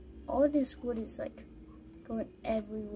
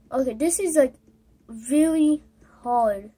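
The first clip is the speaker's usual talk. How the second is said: frustrated outburst; 2 semitones higher, 11 dB louder than usual. Real, not acted. Struggling with the game, frustrated.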